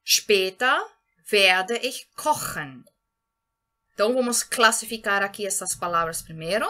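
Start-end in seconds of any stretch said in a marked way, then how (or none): 0.1-2.8 German read very slowly and clearly; slow model sentence. Shown as a demonstration. Teacher gives the next example sentence to students, very slowly. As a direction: lay out each word slowly. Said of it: "Outro exemplo que nós temos aqui".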